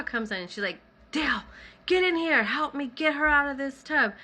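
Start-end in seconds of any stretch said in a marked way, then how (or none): 1.1-4.1 high, raised call for help; urgent calling out. Retold or imitated. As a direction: imitate a grandmother summoning her husband. Grandmother calling grandpa in.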